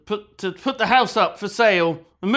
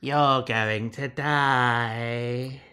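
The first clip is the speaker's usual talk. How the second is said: spooky voice; 6 semitones lower, much slower than usual. Pretend. Spooky voice for note.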